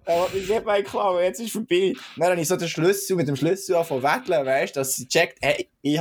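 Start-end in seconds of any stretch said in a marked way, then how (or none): 0.1-1.9 higher-pitched imitation of a frightened cyclist; hammed-up alarm, raised pitch; mock panic. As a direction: act out fearing a theft. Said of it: "sie so richtig verstört".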